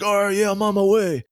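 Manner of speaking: high-pitched mock bluster; worked-up, hammed-up challenge; retold heated threat. Scammer accepts the fight, retold.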